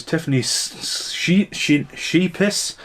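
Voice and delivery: stumbling over She-, She-, ending on a rising Sheepiss?; unsure pronunciation. Real, not acted. Reading an actress's name, unsure how to say it. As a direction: sound the name out haltingly. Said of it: "I don't know how to pronounce that".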